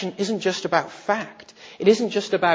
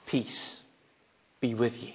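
soft, lower, near-whispered peace; hushed blessing; 7 semitones lower, 11 dB quieter than usual. Acted. Preacher gives Jesus' greeting to the disciples, hushed and soft. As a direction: let it fall quietly, almost breathed. Quoting Jesus again, verse 26.

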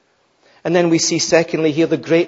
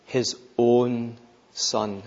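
"own" drawn out slowly with weight; emphatic OWN; much slower than usual. Shown as a demonstration. Preacher showing the Greek emphasis, "own" slow and weighty. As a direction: set "his own Son" apart word by word. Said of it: "in the Greek it's emphatic".

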